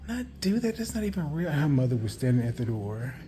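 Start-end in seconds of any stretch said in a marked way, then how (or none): 0.1-1.5 much higher, imitating a young girl denying it; pitched-up girlish protest, whisper on no; Christina's protest. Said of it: "And she was like".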